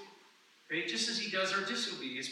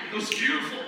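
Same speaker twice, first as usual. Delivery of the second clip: mock rapture; 9 semitones higher, 7 dB louder, faster than usual. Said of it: "Like, thinking".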